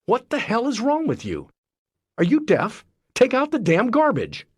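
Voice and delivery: exasperated blaming rant; harsh outburst. Retold or imitated. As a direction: let the irritation boil over. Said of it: "escalate into".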